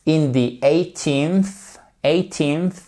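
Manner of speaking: "eighteenth" slowly, stressed on EIGH; stress on first syllable. Shown as a demonstration. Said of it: "elle est sur la première syllabe". (« eighteenth » lentement, accentué sur la première syllabe)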